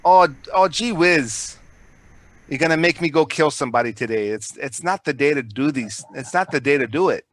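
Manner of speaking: lightly done Minnesota gangster; a hint of Minnesota killer, joking; mock Minnesota tough guy, fading out; Minnesota accent. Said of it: "that Minnesota accent".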